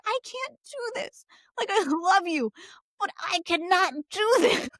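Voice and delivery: pleading, high and strained; high exasperated husband. Retold or imitated. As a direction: beg to be let off, voice up high. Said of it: "he's like".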